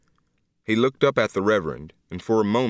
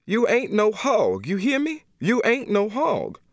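much higher, slow, pleading hard; desperate plea; 11 semitones higher than usual. Acted. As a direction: shake him out of it with her voice. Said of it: "used all her great bulk to pull him over".

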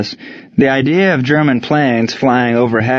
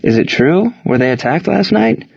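anxious questioning; 7 semitones higher than usual. Acted. Worried player questions coach.

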